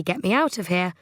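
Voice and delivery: ordinary delivery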